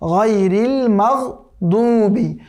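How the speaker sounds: মাগদুবি with heavy দদ, not dal; slow গায়রিল মাগদুবি, thick দদ; correct recitation. Shown as a demonstration. Teacher correcting a common mistake, slow. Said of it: "দালের মতো উচ্চারণ করা যাবে না".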